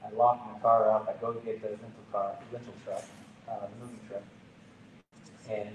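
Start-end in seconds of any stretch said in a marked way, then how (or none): none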